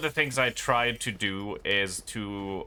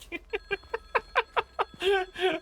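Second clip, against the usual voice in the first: high laugh. Real, not acted.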